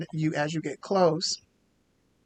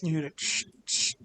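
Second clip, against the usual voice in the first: usual speaking voice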